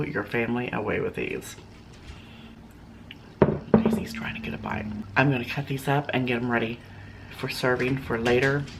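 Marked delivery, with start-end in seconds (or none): 3.7-4.9 whispered aside. Real, not acted. Aside about the dog.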